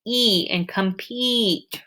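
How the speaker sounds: slow, stretched E in compete; long E in compete. Shown as a demonstration. Teacher stretching the long E in compete, slowly. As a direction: draw out the ee of compete. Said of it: "you hear the sound".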